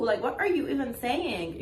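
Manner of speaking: ordinary talk, nothing marked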